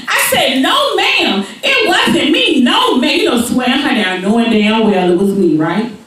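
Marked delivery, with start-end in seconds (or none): 0.6-3.2 high, giggling no ma'am; mock innocent through laughs; laughing denial. Girl swearing to teacher it wasn't her, laughing.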